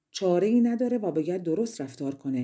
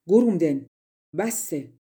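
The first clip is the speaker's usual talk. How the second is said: loud burst on the name, then firm; commanding cry; 5 dB louder than usual. A narrator's reading. Fairy appears and confronts the sorcerer, commanding shout. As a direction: call the offender sharply to a halt. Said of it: "فریاد زد".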